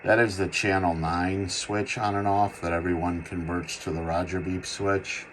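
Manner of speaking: ordinary talk, nothing marked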